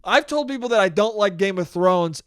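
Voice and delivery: normal talking voice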